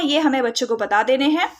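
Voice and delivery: ordinary delivery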